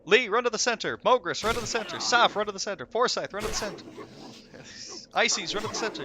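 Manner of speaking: loud, high repeated orders; raid leader roll call. Retold or imitated. Raid leader sending players to center, loud calls. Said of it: "he just goes like".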